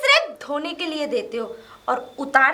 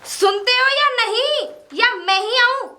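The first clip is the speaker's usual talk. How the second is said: slow, raised, threatening call; impatient shouting; 4 semitones higher, 4 dB louder than usual. Acted. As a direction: call out to your husband, losing patience. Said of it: "कैसे चिल्ला रही हो".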